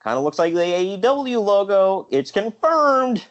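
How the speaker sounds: high, giddy realisation; rising excited, slowing at the end. Retold or imitated. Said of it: "I thought".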